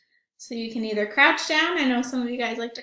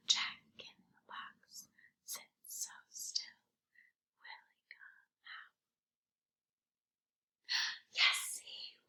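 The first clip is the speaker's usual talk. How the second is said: soft breathy whisper, fading lower and lower; 15 dB quieter than usual. Shown as a demonstration. Librarian whispering the rhyme to the children. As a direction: whisper so the children lean in to hear. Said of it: "Let's do it in a whisper voice this time".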